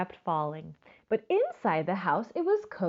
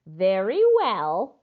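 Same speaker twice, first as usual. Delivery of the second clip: grandma voice; 6 dB louder than usual. Acted. Grandma agrees.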